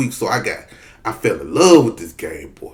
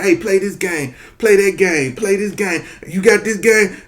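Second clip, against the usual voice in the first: put-on high, eager kid chatter; excited kids; 9 semitones higher than usual. Kids on the bus swap cartridges, high eager kid voices. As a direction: call out like kids trading games.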